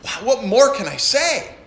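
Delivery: bright, eager wow; bubbling over with wonder; excited preacher reading. Shown as a demonstration. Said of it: "like an excited".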